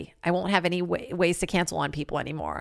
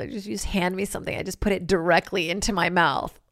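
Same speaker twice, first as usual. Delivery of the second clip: normal talking voice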